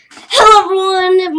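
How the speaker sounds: hello called out high and loud; shouted greeting. Real, not acted. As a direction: belt out the greeting to viewers. Second take of greeting, louder.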